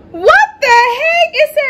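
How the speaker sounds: high, slow squeal of disbelief; real shocked what-the-heck. Real, not acted. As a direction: stretch it out, shocked and loud. Shocked at shirt's back.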